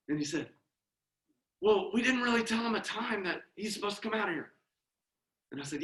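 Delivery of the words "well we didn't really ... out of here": high, softened hiding voice; imitated stage whisper. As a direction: whisper across to a hidden friend. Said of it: "Why are we whispering?".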